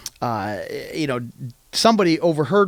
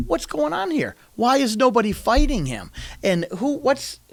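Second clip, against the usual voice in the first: higher, puzzled questions; baffled questioning; 9 semitones higher than usual. Retold or imitated. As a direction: ask in disbelief, pitched up. David questions the army again.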